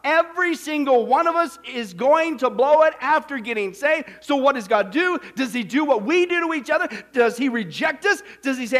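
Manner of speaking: loud, driving sermon delivery; impassioned preaching. Acted. Preacher driving home God's goodness to his congregation, impassioned. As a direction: preach it hard, hammering each example.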